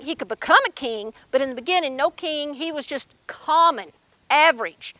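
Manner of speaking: usual speaking voice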